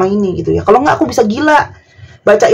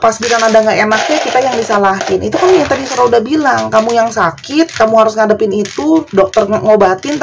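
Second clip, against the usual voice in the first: ordinary talk, nothing marked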